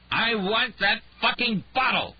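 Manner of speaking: loud, high, slow furious bellow; drunken rage. Acted. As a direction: drag out the demand, drunk and enraged. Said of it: "God damn it!".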